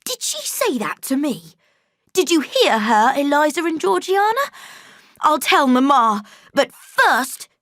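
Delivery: loud, high whining threat; a spoiled boy calling for his sisters; bully threatening. A narrator's reading. Boy threatens Jane, loud and high. John threatens to tell Mamma.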